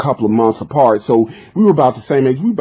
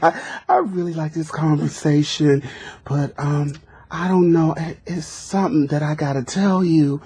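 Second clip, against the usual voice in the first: put-on disguised girl voice; 2 semitones higher, 6 dB quieter than usual. Boy on prank call leading up to the reveal, in a soft girlish voice. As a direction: coyly confess in a put-on female voice. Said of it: "I can't really disguise my voice anymore like that".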